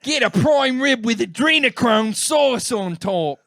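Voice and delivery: high-pitched, drawn-out fake Australian accent; bad Australian accent. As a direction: ham up a terrible Australian accent. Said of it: "the worse your Australian accent is".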